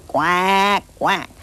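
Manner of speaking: mock duck quacking; playful duck noise; duck quacks. Shown as a demonstration. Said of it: "Let's hear some quacks".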